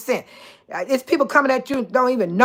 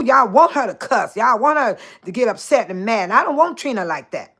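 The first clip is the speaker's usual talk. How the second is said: normal talking voice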